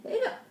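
squeaky jumped-up hiccup on the word; cute little piglet hiccup; high hiccup. Acted. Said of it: "milk all over him".

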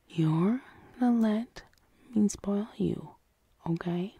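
soft intimate whisper close to the ear. Acted. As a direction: breathe the words gently, coaxing. Soft comforting partner roleplay.

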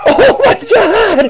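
shrieking oh my god, drawn out; high, slow, amazed shout; excited shout. Real, not acted. Reacting to Homer punching wall.

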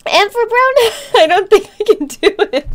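high, loud, quick words through laughter; tearful laughing. Real, not acted. Streamer greeting a viewer, too overcome to cope, tearful laughter. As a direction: struggle to speak while laughing and crying. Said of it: "while I cry".

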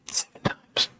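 barely audible whisper; thinking to herself. Acted. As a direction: whisper it under the breath, as a private thought. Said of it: "she's thinking".

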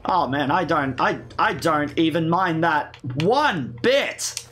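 slow, exaggerated sarcastic drawl; heavy sarcasm. Real, not acted. Gamer pretending not to mind killing all the pustules, heavy sarcasm. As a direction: lean hard on the words, obviously not meaning them. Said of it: "Can you tell the sarcasm in my voice?".

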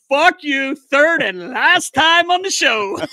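high, loud and slow, mock fury; mock furious retort. Pranked show guest fires back at the host, hammed-up loud slow fury.

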